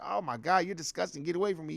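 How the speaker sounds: mocking the rude girl. Rude girl brushing off his homeboy, soft mock disdain. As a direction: brush him off softly like a stuck-up girl. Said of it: "She was like".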